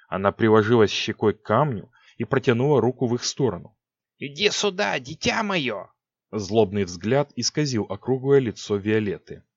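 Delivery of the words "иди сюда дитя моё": high eerie witch voice; crooning sorceress beckoning. A narrator's reading. Six voiced in story.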